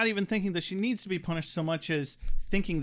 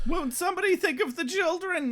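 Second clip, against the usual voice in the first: mock alarmed; 12 semitones higher than usual.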